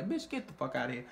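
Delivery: ordinary delivery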